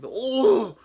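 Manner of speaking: loud, high mock vomiting groan; mock retch. Man throwing up mid-stride while suggesting food, mock retch. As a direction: cut yourself off with a loud retching "oh".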